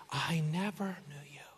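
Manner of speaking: slow, hushed, final verdict; grave whisper. Acted. Jesus to the false believers, grave whisper. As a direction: whisper the sentence with weight.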